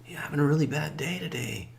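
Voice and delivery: high soft consoling voice; gently sympathizing old man; sympathetic. Retold or imitated. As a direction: comfort him softly. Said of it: "old man comforts drunk".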